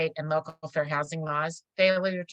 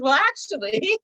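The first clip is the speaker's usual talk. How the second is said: playful gotcha; 9 semitones higher, 5 dB louder than usual. Retold or imitated. Retelling her own smug reply.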